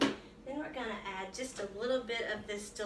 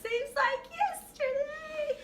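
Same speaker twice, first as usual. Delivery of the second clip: high, slow mock-weepy sigh; hammed-up wistful crying voice; mock sentimental; slower than usual. Echoing friend's sentimental line.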